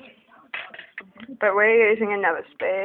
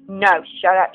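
usual speaking voice

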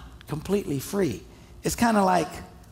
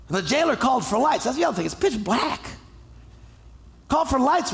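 ordinary talk

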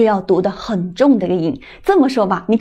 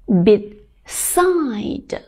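"Beside" with a long, falling, stressed "side"; stress on second syllable. Shown as a demonstration. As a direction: split "Beside" and lean on the drawn-out "side". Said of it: "后面这个部分读得重一点".

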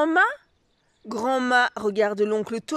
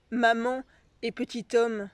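usual speaking voice

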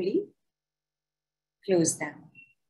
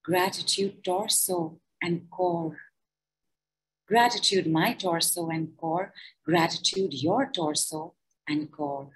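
guided gratitude meditation. Acted. Leading listeners through a body gratitude decree, calm meditation voice. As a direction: guide them gently through each body part.

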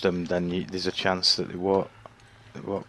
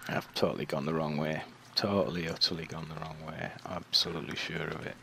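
resigned; 4 semitones lower, 9 dB quieter than usual. Real, not acted. Resigned at wrong way.

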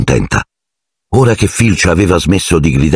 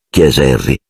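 normal talking voice

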